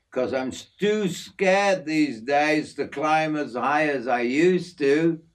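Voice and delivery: easygoing outback mate showing off his aviary; hint of a broad Australian working man; Aussie bloke Jim.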